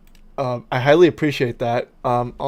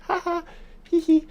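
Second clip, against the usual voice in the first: high giggle; faster than usual. Pretend. Giggling after own joke.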